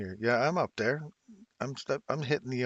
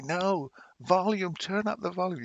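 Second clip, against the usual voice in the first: a loud, slow "No, volume" pitched higher; shouting old man; 7 semitones higher, 4 dB louder than usual. Pretend. In his sixties, telling chat to turn up the volume loudly. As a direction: bark it slowly like a hard-of-hearing old man. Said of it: "You're gonna have to say".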